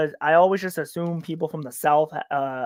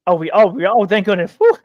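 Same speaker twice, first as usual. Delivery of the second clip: relieved exclamation; 3 semitones higher, 8 dB louder than usual. Real, not acted. Relief about the same time zone.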